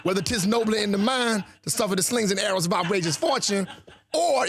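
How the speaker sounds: hammed-up Detroit accent; Detroit urban dialect. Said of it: "as opposed to an urban dialect like that".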